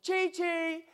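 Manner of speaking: put-on high-pitched sing-out to a toddler; retold calling out. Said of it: "I just shouted out".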